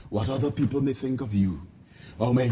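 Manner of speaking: ordinary delivery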